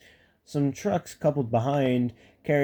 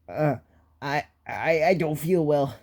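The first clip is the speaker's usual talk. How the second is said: quavering. A narrator's reading. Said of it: "quavered Sir Handel".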